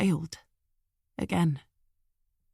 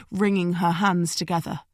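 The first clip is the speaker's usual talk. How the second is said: usual speaking voice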